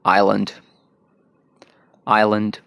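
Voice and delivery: आइलैंड in an American accent; American English island. Shown as a demonstration. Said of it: "American में".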